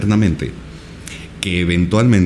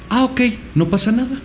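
wife's easygoing reply. Retold or imitated. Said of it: "Le digo".

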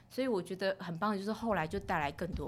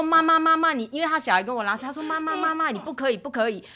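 high, loud pleading warning; mock principal calling mother off; principal's warning; 8 semitones higher, 10 dB louder than usual. Principal stopping the mother at the gate, high and loud. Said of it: "校长也跟我说".

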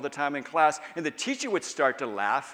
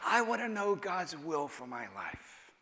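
seeker's request; 4 semitones higher, 4 dB quieter than usual. Retold or imitated. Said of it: "People often come to pastors and say".

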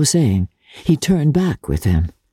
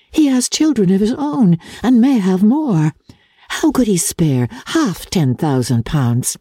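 higher, hopeful sisterly voice; hopeful elder sister; 7 semitones higher than usual. Acted. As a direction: comfort yourself and her with reasoning. Jane voiced in story.

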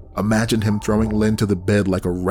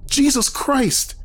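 high, slow horrified cry; horrified outburst; slower than usual. Acted. Narrator hearing the attack over the phone, horrified cry. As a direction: cry out in shock at what you hear.

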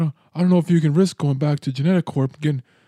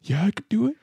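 higher, slightly crazed voice, rising at the end; crazy Glenn; 4 semitones higher than usual. Acted. Game master voicing Glenn's answer to the team, crazy. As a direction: answer as a slightly unhinged fighter. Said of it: "He looks a little crazy all of a sudden".

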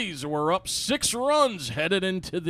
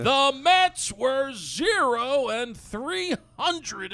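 "zero and three hundred" spelled out slowly, higher; slow repeat for emphasis; 7 semitones higher, much slower than usual. Shown as a demonstration. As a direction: repeat "The Mets were zero" slowly, word by word. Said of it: "Let me repeat that for people that can't hear".

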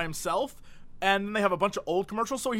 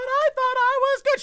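put-on much higher pleading old lady; old mom; 4 dB louder than usual. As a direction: whimper like a hurt granny. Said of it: "It's like".

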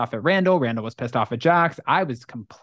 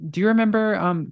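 usual speaking voice

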